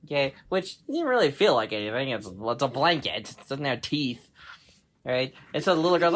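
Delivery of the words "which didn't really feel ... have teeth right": ordinary delivery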